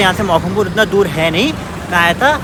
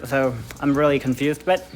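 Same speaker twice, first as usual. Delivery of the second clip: slow, careful put-on American accent; showing off an American accent, slowly; much slower than usual. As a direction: speak English in a deliberate American accent. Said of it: "I speak in American accent".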